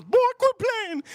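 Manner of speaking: very high, drawn-out falsetto; stripper imitation. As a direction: imitate her in a slow, squeaky-high voice. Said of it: "She goes".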